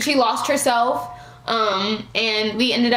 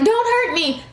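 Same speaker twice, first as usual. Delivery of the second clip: mock crying; 8 semitones higher than usual. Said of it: "So she started crying and was like".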